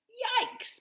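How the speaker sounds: very high squeal of alarm; startled yikes. Acted. Betty seeing the flood pour out, high yelp. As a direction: yelp it in sudden fright.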